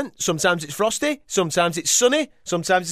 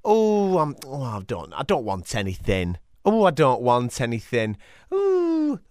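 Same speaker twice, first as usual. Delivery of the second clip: coy woman; 6 semitones lower, 5 dB quieter than usual. Acted. Woman's coy refusal acted out.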